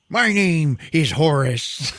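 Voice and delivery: high, slow mock-grand proclamation with a laugh; parody reveal. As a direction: proclaim it slowly and far too grandly. Joke repeat of the reveal.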